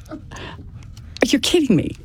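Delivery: breathless whisper, slow and drawn out; stunned disbelief. Retold or imitated. Told her husband was shot, she gasps in whispered disbelief. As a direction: barely get it out, stunned. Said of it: "I just kinda went".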